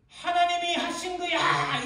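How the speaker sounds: loud, high outcry, words drawn out. Acted. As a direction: cry it out at full voice in accusation. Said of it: "이렇게 외치는 거예요". (높고 크게 길게 외치는 소리)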